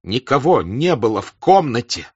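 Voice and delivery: agitated. Acted. Rostov agitated confrontation.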